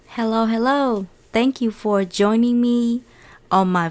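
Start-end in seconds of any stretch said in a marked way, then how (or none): none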